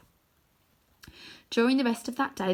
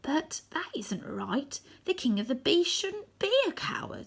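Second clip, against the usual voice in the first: scarecrow voice; 7 semitones higher than usual. Acted. Scarecrow telling the lion a king shouldn't be coward, in scarecrow voice. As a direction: give it a strange straw-man tone.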